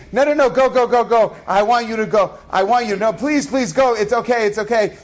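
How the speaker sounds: high, repeated mock urging; pleading insistence. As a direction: imitate a wife pressing him to leave. Acting wife's real meaning, urging him.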